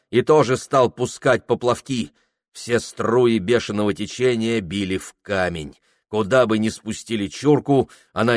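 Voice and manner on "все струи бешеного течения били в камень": plain reading voice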